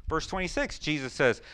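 usual speaking voice

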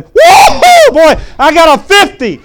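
whooping it out, high and loud with joy; excited shout. Retold or imitated. Student leaving the teacher's office, shouting with joy. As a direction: yell with relief like you just escaped expulsion. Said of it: "And I walked out saying".

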